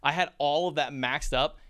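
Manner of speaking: ordinary delivery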